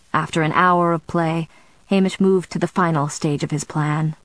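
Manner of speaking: plain reading voice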